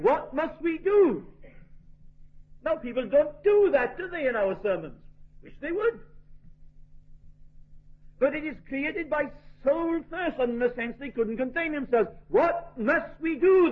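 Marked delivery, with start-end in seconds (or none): 0.0-1.3 high, loud, drawn-out plea; crying out. Retold or imitated. Preacher voices the crowd's cry, crying out. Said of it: "the people cried". 12.3-13.7 raised, slow, emphatic cry. Retold or imitated. Preacher repeats the convicted crowd's plea, crying out. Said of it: "they cried".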